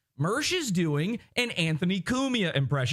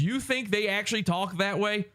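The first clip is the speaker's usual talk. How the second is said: usual speaking voice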